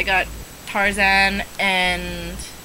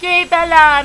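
high, loud yay; cheering; 6 semitones higher, 8 dB louder than usual. Pretend. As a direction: cheer loudly for the puppy. Cheering each puppy.

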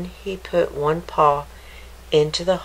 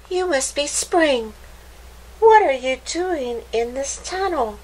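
put-on higher, curious young voice; eager little rabbit; 8 semitones higher than usual. A narrator's reading. Hopper asking the mole in the tunnel, curious. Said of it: "said Hopper".

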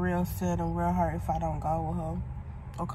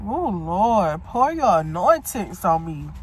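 prayer; 6 dB louder, slower than usual. Real, not acted. Praying for healing while sick.

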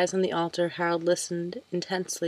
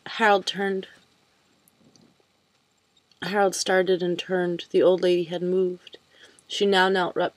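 plain reading voice

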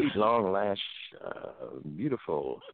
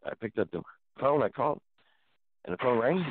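normal talking voice

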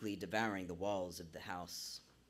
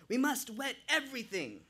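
put-on urgent Jamaican accent, raised pitch; 4 dB louder than usual. A narrator's reading. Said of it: "with a thick Jamaican accent".

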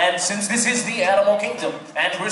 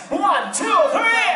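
rising count-in; 11 semitones higher than usual. Real, not acted. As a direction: build the count to a shout. Count-in for lion roar.

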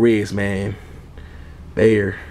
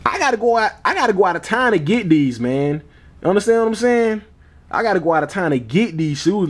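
excited; 8 semitones higher than usual. Real, not acted. High excited insistence.

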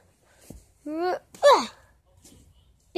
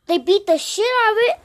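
loud, higher, rushed; a quick excited shout; loud fast outburst; 6 semitones higher, 11 dB louder, faster than usual. Real, not acted. Excited warning.

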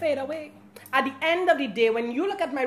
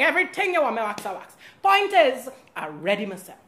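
ordinary talk

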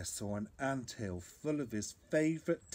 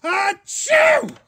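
shouted, very high explosive achoo; huge mock sneeze; 21 dB louder than usual. Bear sneezes beside the cherry tree, huge acted sneeze. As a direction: let a storybook sneeze explode.

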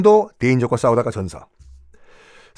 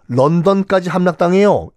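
ordinary talk, nothing marked

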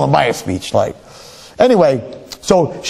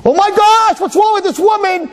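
shrill, shouted mock outrage; hammed-up indignant wife. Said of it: "she's saying".

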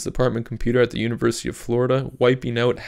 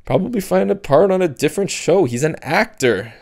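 lightly voiced higher teen girl, explaining; teen girl Sophie; 6 semitones higher, 4 dB louder than usual. Sophie explaining TV to Cap, lightly voiced condescending teen. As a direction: talk down to her like it's obvious.

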